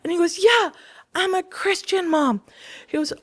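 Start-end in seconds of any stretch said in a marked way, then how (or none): 0.4-2.4 high, loud, beaming announcement; eager kid voice. Retold or imitated. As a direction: announce it proudly to mom. Son proudly announcing.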